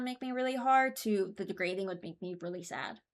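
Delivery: usual speaking voice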